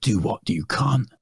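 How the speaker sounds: Jason Statham impression. Hard man answering a cheeky pub insult, lightly voiced tough guy. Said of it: "And he's gone".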